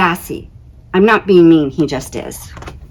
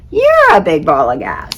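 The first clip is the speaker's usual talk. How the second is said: Jupiter retort. Acted.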